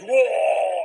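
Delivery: loud, high booming imitation; huge-voiced shout. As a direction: blast it out in one big burst. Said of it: "grabe yung boses". (malakas na sigaw)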